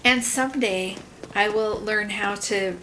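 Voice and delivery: usual speaking voice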